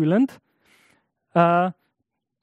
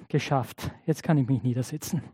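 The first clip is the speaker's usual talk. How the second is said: softer, lower, worn-out sigh of relief; exhausted relief; 6 semitones lower, 5 dB quieter than usual. Retold or imitated. Petrus, worn out after preaching, quietly relieved and exhausted. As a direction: let it sink out like a tired man finally done. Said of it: "dass er an diesem Punkt jetzt echt erschöpft ist".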